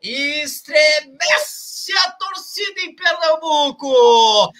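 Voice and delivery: long drawn-out sung goal shout; explosive goal commentary. Real, not acted. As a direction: stretch every syllable like a chant. Goal celebration after the free kick.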